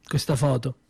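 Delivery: normal talking voice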